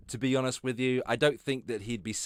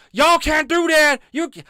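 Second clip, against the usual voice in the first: put-on failed Southern accent; 14 semitones higher, 11 dB louder than usual. Mocking Frank yelling at Karens in a bad Southern accent. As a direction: bellow it in a bad Southern twang, much higher. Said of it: "I can't do Frank's accent".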